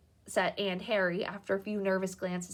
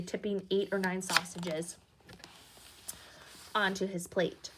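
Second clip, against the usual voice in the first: usual speaking voice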